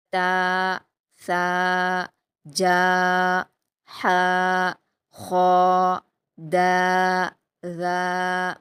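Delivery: each syllable تا, ثا, جا held long with a pause after; drawn-out letters. Shown as a demonstration. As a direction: model each letter slowly for the class. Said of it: "روانگی سے پڑھیں گے". (ہر حرف کو دو حرکات کھینچ کر)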